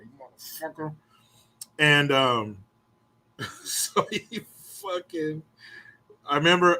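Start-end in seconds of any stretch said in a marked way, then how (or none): 0.0-0.9 softer muttered curse while choking him; muttered Ralphie curse. Retold or imitated. Kid choking the bully, muttered Ralphie curse. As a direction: mutter it like Ralphie in A Christmas Story. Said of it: "I was like Ralphie in Christmas Story".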